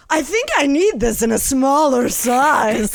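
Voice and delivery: loud, high, drawn-out show-off; showing off to impress. Retold or imitated. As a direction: announce it so the celebrities notice. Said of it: "trying to, like, impress the Spears".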